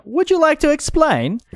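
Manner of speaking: very high character voice; the other guy. Acted. The other guy asking for explanation, very high voice. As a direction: pitch the question way up, in character. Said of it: "that's the other guy".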